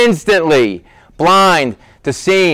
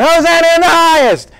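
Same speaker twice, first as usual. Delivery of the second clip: put-on children's shout; 13 semitones higher than usual. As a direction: ring it out like kids running through the temple. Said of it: "they're screaming this".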